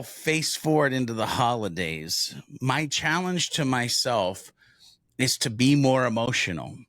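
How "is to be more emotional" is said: ordinary talk, nothing marked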